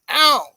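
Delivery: high, loud imitated cry of pain; retold yelp. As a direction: yelp like a toddler taking a tumble. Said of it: "and she said".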